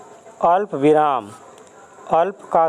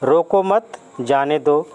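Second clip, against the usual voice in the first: रोको मत joined, then जाने दो apart; pause after मत. Shown as a demonstration. Teacher shows the meaning changed by the comma, pause after मत. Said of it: "के बाद लगाया जाए".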